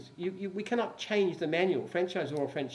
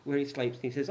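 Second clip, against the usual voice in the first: usual speaking voice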